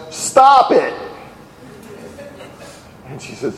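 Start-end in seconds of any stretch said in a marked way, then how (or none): 0.1-0.9 mock yell. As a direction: yell it as a sudden comic order. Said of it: "and he yells".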